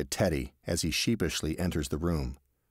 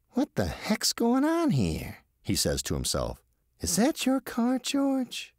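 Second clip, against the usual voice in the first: higher, puzzled voice of Teddy talking to himself; slower than usual. Acted. Teddy muttering to himself at the roadside, higher puzzled voice. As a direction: wonder aloud at the strange car. Teddy spots his friend's car.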